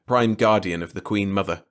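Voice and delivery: ordinary delivery, nothing marked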